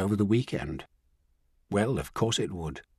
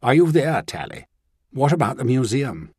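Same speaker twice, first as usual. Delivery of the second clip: a sharp, louder, higher impatient call; 4 semitones higher, 4 dB louder than usual. A narrator's reading. Woman on the phone, calling for the housekeeper, impatient. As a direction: demand an answer at once. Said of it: "Caroline's voice was impatient".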